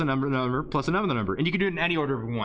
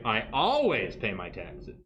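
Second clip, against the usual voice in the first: slow, with "always" stretched and lifted; stress on ALWAYS; 4 semitones lower, slower than usual. Shown as a demonstration. Teacher showing emphasis changes meaning, stress on "always". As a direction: lean hard on the word "always".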